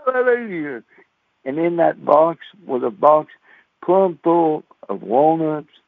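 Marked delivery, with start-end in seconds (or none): 0.0-0.8 mama voiced high and excited; mama calling. Mama calls her son to the box, high and excited. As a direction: call your boy over, overjoyed. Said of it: "And she said".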